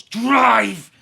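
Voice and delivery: high, very loud bark; shouted order. Retold or imitated. Intruder in the car ordering the driver, barking loudly. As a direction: bark it like a hijacker.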